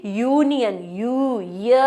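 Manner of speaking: यूनियन reduced to यू, then य as "yuh"; teacherly breakdown, यूनियन, यू, य; sounding out onset. Shown as a demonstration. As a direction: isolate the y-glide that starts यूनियन. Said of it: "यूनियन word को pronounce करो".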